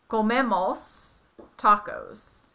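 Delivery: "comemos tacos" drawn out slowly, each syllable clear; slow it down for the learner; slow careful Spanish. Shown as a demonstration. Parent spells out the we form slowly. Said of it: "would be".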